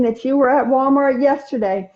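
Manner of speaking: ordinary delivery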